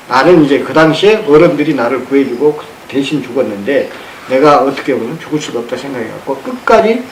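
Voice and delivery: ordinary talk, nothing marked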